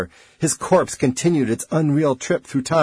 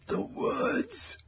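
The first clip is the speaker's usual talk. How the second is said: soft, high, thin quaver; 10 dB quieter than usual. A narrator's reading. Rapidly aging man speaking from the chair, quavering. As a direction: let the words waver, frail and hushed. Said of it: "Corrigan said in a quavering voice".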